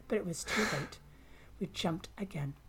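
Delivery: ordinary delivery, nothing marked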